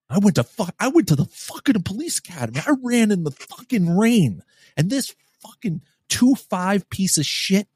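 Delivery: high-pitched outraged rant; re-enacted livid tirade, pitch way up. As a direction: rail indignantly, slowing down on the final insult.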